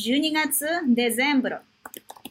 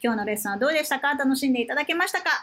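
rattled off quickly; fast sign-off; much faster than usual. Real, not acted. Teacher rushing through the goodbye, fast. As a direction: hurry through the closing lines. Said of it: "rushed lesson sign-off".

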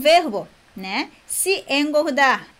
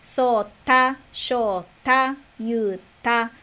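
"Sota, shota, yuta" each split before "ta"; final syllable set apart; much slower than usual. Shown as a demonstration. Sho, ta. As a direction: separate the last syllable: so, ta. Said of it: "mas é ta".

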